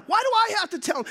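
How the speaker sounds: high, fast complaint; mock whine of a reluctant messenger; whiny protest. Philip refusing to go back to Jesus, a high quick whine.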